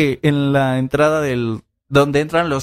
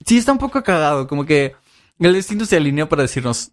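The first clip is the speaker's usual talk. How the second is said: usual speaking voice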